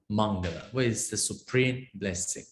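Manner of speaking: ordinary talk, nothing marked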